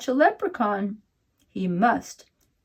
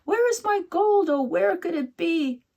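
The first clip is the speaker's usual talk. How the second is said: much higher fretful leprechaun whine; mumbling leprechaun; 8 semitones higher than usual. A narrator's reading. Leprechaun mumbles in the hayfield, high and whiny. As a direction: worry aloud like a tiny cross man.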